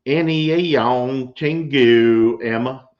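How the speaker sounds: put-on slow, drawn-out Southern accent, loud; hammed-up Southern drawl reading the message. Reading a viewer's message aloud in a hammed-up Southern accent. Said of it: "read this in my best Southern accent".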